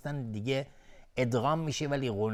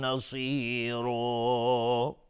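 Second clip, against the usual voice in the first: slow, drawn-out chanted recitation; measured Quranic chant; 3 semitones higher, much slower than usual. Acted. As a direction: intone the verse slowly and melodically.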